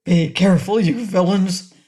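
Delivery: loud gruff warning; gruff cry. A narrator's reading. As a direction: bark it at the clumsy men. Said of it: "a gruff voice cried".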